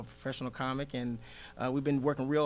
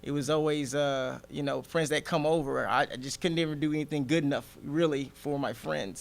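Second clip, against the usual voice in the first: ordinary talk, nothing marked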